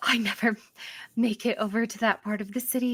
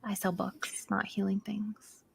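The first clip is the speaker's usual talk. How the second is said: curt whispered reply; 10 dB quieter than usual. Acted. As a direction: hush it flatly.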